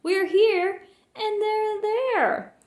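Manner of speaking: high, slow despairing moan. A narrator's reading. Horton to the bug at the peak, a high slow moan. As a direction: stretch it out in misery. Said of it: "Horton moaned".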